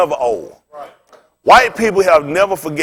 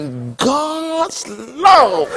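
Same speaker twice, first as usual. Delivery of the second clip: hugely stretched, higher-pitched mimicry; slow-talking preacher; 7 semitones higher, much slower than usual. Imitating the preacher who won't hate, very drawn-out. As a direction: draw each word out in mock piety.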